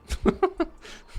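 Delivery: a quick, high airy laugh at his own joke; high breathy laugh. Real, not acted. Streamer laughing at his own joke to chat, a high airy laugh. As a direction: let out a high, breathless chuckle.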